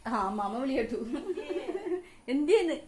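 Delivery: laughing. Real, not acted. Grandma answers the baby's call.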